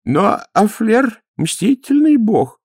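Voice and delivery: high, slow character voice; objecting priest. A narrator's reading. Priest at the back of the crowd, objecting.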